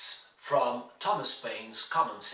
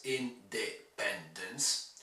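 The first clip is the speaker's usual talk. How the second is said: 'independence' slowly as in - de - pen - dence; slow, softer, evenly separated syllables in 'independence'; 5 semitones lower, 7 dB quieter, much slower than usual. Shown as a demonstration. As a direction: split 'independence' into four parts. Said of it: "constituted of four syllables".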